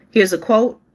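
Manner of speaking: ordinary talk, nothing marked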